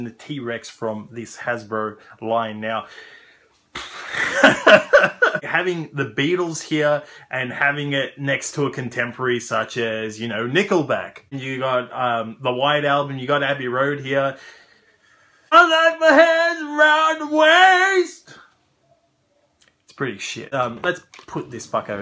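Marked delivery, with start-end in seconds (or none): 3.7-5.4 1 a burst of laughter. Real, not acted. Mocking the toy comparison. 15.5-18.3 high, slow put-on silly voice; mock toy voice. Reviewer voicing the cheap toy, high silly put-on voice.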